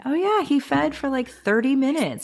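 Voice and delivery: high, chirpy and falsely confident; breezy fib. Retold or imitated. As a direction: brush it off brightly, hoping they believe it. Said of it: "and I'd say".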